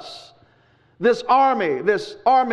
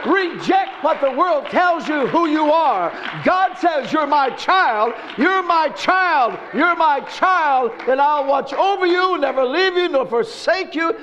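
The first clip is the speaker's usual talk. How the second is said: preaching at full force; 4 semitones higher than usual. Acted. Preacher rallying the congregation, fervent and high. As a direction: lift the church with the promise. Preaching climax, God's promise.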